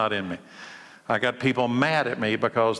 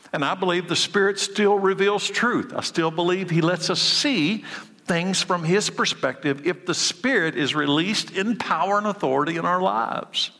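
higher, building conviction; preaching fervor; 5 semitones higher than usual. Acted. Pastor building up to congregation about the Spirit, fervent. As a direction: lift it like a preacher's climax.

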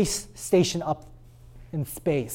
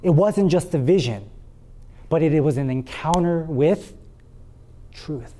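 normal talking voice

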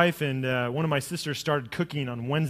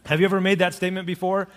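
ordinary talk

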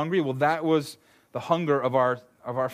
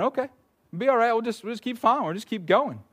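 high, cheery little duckling voice; baby duck voice; 8 semitones higher, faster than usual. Retold or imitated. Pastor voicing the trusting baby ducks, high and carefree. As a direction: play a naive duckling happily following along. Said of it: "just like those little baby ducks".

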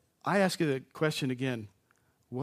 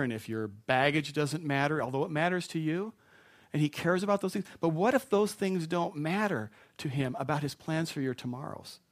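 ordinary delivery

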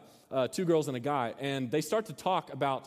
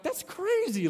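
put-on shocked girl; slower than usual. Pastor voicing the girl aghast at the boyfriend, high surprised cry. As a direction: pitch leaps up on a mock gasp of disbelief. Girl reacts to cold showers.

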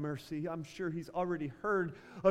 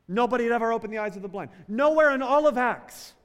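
loud, rising sermon delivery; fiery pulpit preaching; preaching at full force; 4 dB louder than usual. Acted. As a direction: preach it out, pressing each phrase. Preacher building to climax.

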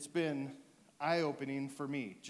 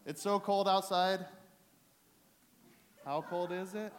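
mock call-out; 7 semitones higher, 9 dB louder than usual. Repeats bit, prompting the crowd.

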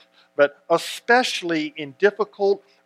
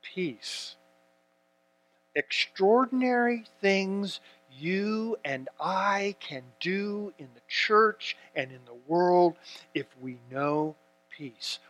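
hushed; 3 semitones higher, 7 dB quieter, slower than usual. Acted. Sermon drops to a hush.

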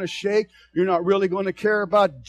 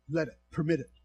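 ordinary delivery

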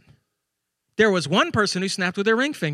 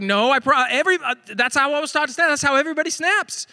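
rising higher, protesting; indignant protest; 6 semitones higher than usual. Retold or imitated. Husband protesting to wife about snapping, rising indignant. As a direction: insist it's obvious, climbing up. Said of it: "And I was like".

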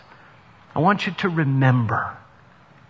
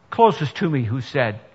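ordinary talk, nothing marked

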